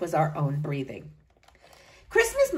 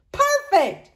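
high, bright crow of triumph; 12 semitones higher, 4 dB louder than usual. A narrator's reading. Grandmother crowing over the gifts, high. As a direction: crow it out, pleased. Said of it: "she crowed".

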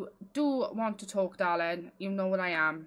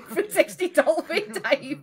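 laughing repeat; 6 semitones higher, 8 dB louder than usual. Real, not acted. Laughing repeat of friend's phrase.

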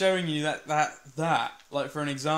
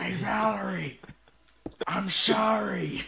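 drawn-out loud put-on voice; slow loud character voice; 4 semitones higher, 4 dB louder, slower than usual. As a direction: holler it slowly in character. Impression shout, slow.

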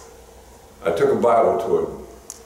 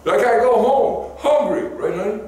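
full-force preaching; 12 semitones higher than usual. Acted. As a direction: rouse the congregation.

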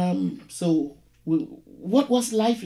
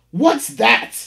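sharp, higher, louder "What's that?"; clanged landlady; 9 semitones higher, 8 dB louder than usual. Acted. Landlady snapping at the caller down the phone, loud and high. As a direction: clang it hard into the mouthpiece. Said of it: "clanged her accent, hard on the mouthpiece".

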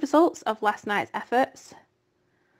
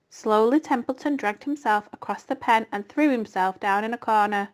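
plain reading voice

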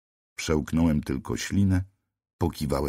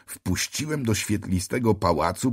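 plain reading voice

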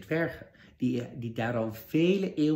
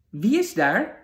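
higher and louder call to the door; 8 semitones higher, 9 dB louder, slower than usual. Acted. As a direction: call out to whoever knocked. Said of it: "riep Sneeuwwitje".

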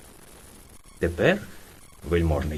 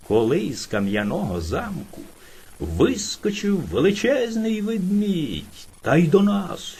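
ordinary delivery, nothing marked

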